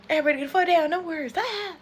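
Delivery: high mock yell to take cover; hammed-up mock warning call; hammed-up alarm. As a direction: shout it like a fake panic, half-joking.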